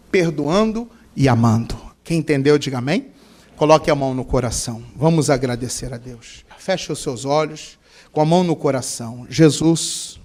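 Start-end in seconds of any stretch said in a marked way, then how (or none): 3.6-10.2 low, hushed prayer voice; earnest, subdued pleading with God; closing prayer. Real, not acted. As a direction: lead the congregation gently into prayer. Leads the congregation in closing prayer.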